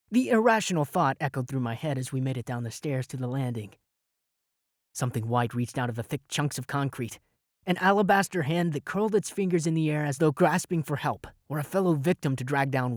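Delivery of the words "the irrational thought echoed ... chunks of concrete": ordinary delivery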